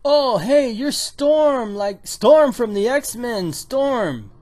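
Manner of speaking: high, loud fan-like exclamation; acted gushing recognition, loud and high; starstruck surprise. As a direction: blurt it out, drawing out the hero's name. Acts out America's starstruck surprise.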